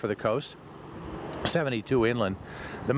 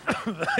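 coughing fit; 9 semitones higher than usual. Real, not acted. Cough mid-sentence on air.

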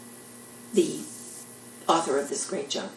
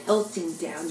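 normal talking voice

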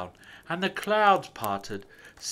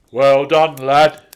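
loud hearty Santa; loud jolly praise; Santa praising; 16 dB louder than usual. A narrator's reading. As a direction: praise the little reindeer warmly.

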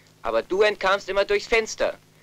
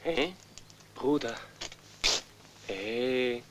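normal talking voice

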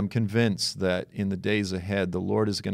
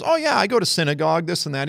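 higher, fast, breezy imitation of the seatmate; mock New York man; 9 semitones higher, 5 dB louder, faster than usual. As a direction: toss it off like a confident New Yorker.

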